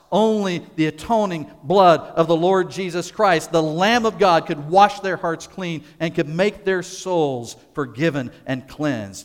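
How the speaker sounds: loud, fervent preaching build; rising preaching. Acted. Preacher to congregation, fervent loud proclamation. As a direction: proclaim it with growing force.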